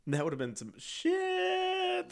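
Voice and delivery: slow, drawn-out, high-rising last word; mock dread. Reacting to nearly being sent home, comic mock dread. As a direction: stretch the ending into a high squeal. Acted reaction to a near elimination.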